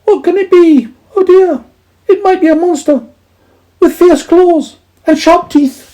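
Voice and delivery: very high, loud squeaky voice; frightened mice. A narrator's reading. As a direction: fret like tiny scared mice. Mice fear a monster.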